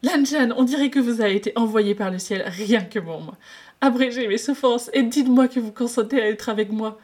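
cheeky lovestruck patient pushing his luck; playful flirt. Acted.

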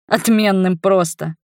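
ordinary delivery, nothing marked

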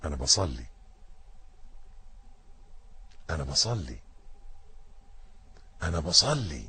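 soft low murmur; telling yourself. Shown as a demonstration. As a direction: remind yourself quietly, inward. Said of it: "قول لنفسك".

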